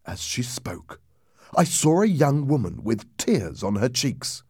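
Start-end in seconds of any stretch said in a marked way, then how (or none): none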